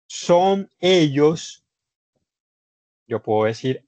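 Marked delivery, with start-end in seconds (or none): 0.1-1.6 son ellos drawn out slowly and higher; lento, como dictando; slow, spelled out. Shown as a demonstration. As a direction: stretch son ellos out for the class. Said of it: "quiero decir".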